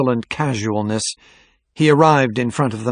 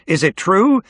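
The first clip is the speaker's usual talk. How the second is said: high, raised questioning call; startled onlooker calling out; shout from a crowd; 9 semitones higher, 5 dB louder than usual. A narrator's reading. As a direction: call it out from the audience. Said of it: "some murmurings and scattered shouts".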